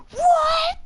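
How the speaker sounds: very high, loud excited yelp; shouting in disbelief. Retold or imitated. Excited kid hearing she plays Minecraft, shouting. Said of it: "he's shouting it".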